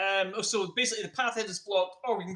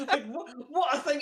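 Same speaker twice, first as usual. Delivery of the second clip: shocked laughing outburst; 9 semitones higher than usual. Real, not acted. Shocked at a lucky guess.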